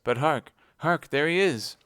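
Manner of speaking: straining to hear the madman below; alarmed hush to cry. Acted.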